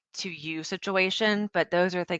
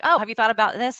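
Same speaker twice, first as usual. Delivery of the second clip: higher, louder, keen to offer a fix; mock-helpful fixer, higher and louder; eager fixer; 5 semitones higher, 7 dB louder, faster than usual. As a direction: jump in brightly with a suggestion. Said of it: "I'm like".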